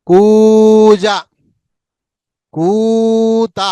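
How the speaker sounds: put-on long slow model words for kids to repeat; drawn-out teaching model. Shown as a demonstration. Teacher drawing out words for children to copy. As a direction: stretch each word very slowly, loud on the first. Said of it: "నాతో పాటు మీరు కూడా పలకాలి".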